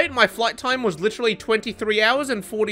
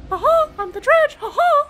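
goofy, very high-pitched monster voice; Dredge. Acted. Player imagining the killer's voice, goofy high-pitched squeak. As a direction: squeak it like a silly cartoon mouse. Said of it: "really goofy, high-pitched voice".

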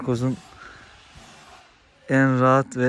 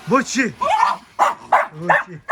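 much higher call of the name; high pet call; 9 semitones higher, 5 dB louder than usual. Real, not acted. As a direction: sing out the dog's name. Calling the dog high.

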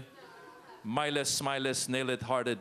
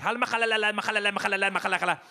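mock Arabic gibberish; 4 semitones higher, 3 dB louder, faster than usual. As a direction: fake Arabic babble, pitched higher. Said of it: "They were like".

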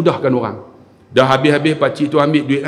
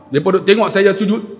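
usual speaking voice

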